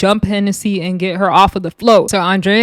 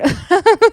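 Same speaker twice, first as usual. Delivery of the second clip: short high real giggle; 11 semitones higher than usual. Real, not acted. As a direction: burst into a quick high laugh. Laughing at nuns in crowd.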